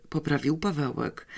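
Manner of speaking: ordinary delivery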